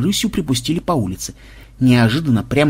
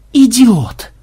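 high, loud outburst; far-carrying yell of outrage; exasperated insult; 7 semitones higher, 5 dB louder than usual. A narrator's reading. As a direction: hurl it after a reckless friend. Yelling at reckless charging friend.

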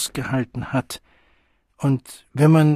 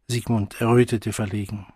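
usual speaking voice

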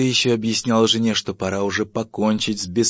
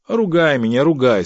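loud, high martyred defiance; defiant; 6 semitones higher, 8 dB louder than usual. Acted. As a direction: dare the wife to keep scolding. Husband defies scolding wife. (громко, с вызовом)

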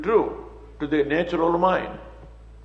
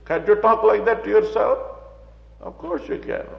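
ordinary talk

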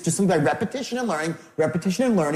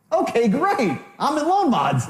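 mock delight, pitched way up; tone-deaf salesman cheer; over-upbeat wrong reply; 9 semitones higher, slower than usual. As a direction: chirp it as if it's great news. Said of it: "No, you don't have to say that".

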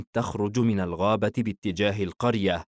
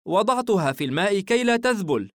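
higher mother's voice; motherly explanation, pitched up; reassuring mother; 11 semitones higher, 5 dB louder than usual. Acted. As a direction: play a mother calmly answering her child.

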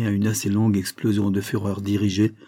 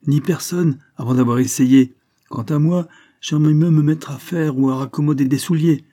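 scolding lecture; 6 semitones higher, 5 dB louder than usual. A narrator's reading. Cornélie lectures Isabelle.

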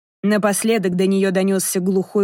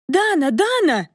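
high-pitched, drawn-out yell without full volume; frantic wailing cry; 10 semitones higher than usual. A narrator's reading. Olya wailing down the phone to her sister, frantic high cry. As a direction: cry the name out high, as if being cut. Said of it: "Оля вопила так".

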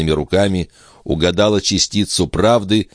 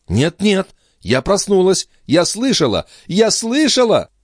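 frantic insistence; 14 semitones higher than usual. Acted.

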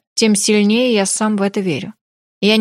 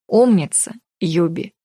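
ordinary delivery, nothing marked

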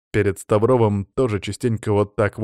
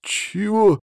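high, astonished question; pitched-up, stunned reaction; gasped in shock; 10 semitones higher than usual. A narrator's reading. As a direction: gasp it in disbelief. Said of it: "ахнул Городецкий".

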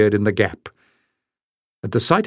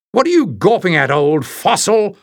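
roared insult; 7 semitones higher than usual. Acted. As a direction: sneer it out at full volume. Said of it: "roared the giant stranger".

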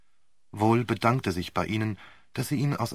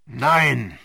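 loud, higher, forceful interruption; thundering no; 4 semitones higher, 9 dB louder than usual. Acted. Sir Wilfrid cuts Romain off with a thundering no. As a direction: cut her off with a booming no. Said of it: "donnerte Sir Wilfrid dazwischen".

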